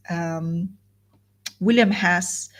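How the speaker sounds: usual speaking voice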